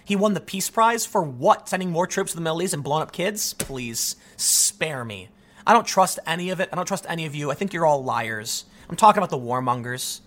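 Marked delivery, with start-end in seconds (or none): 3.5-5.3 contemptuous. Real, not acted. Scorn for Obama's Peace Prize.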